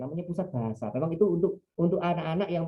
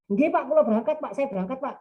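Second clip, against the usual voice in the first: eager, higher yes-sir to the father-in-law; eager reply; 8 semitones higher, 4 dB louder than usual. Retold or imitated. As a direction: answer the father-in-law keenly. Young man answering father-in-law. (jawaban bersemangat kepada calon mertua)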